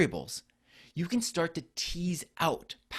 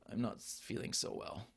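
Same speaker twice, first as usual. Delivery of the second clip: sick voice; 3 semitones lower, 10 dB quieter than usual. Retold or imitated. Sick user answers assistant.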